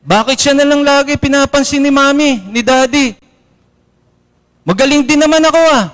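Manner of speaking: lightly voiced sulky child; a child whining about Mommy and Daddy; sulky complaint, pitch a bit up; jealous child. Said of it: "Minsan sasabihin natin".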